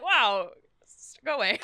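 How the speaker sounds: high, amused brush-off; laughing go away; mock put-off girls. Others voiced, laughing.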